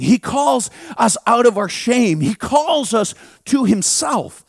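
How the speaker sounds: normal talking voice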